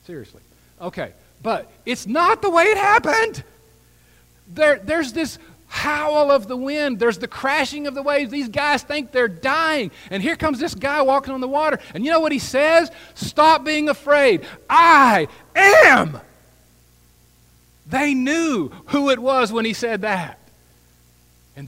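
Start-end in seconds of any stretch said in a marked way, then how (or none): 1.4-12.9 high, loud, urgent preacher's delivery; full-force preaching. Real, not acted. 13.2-16.2 high, very loud proclamation over a storm; full-voiced shout, landing hard on each word; commanding shout. Jesus calling over the storm. 17.9-20.4 high, loud, urgent preacher's delivery; full-force preaching. Real, not acted.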